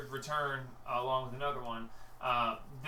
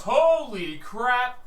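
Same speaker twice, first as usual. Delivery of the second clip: slow, loud, drawn-out exclamation; stunned guard; 10 semitones higher, 11 dB louder, much slower than usual. Acted. Guard reacts to the vanishing, slow and loud. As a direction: stretch it out in shock. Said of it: "the guy goes".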